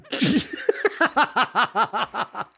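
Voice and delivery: high giggling laugh; a real laugh at her own pun; long laugh. Real, not acted. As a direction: burst out laughing.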